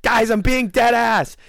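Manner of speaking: high, slow imitation of an annoyed waitress; imitating the waitress. Waitress scolding the table, high slow mock warning. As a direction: mimic her fed-up warning, slowly and high. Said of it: "and she goes".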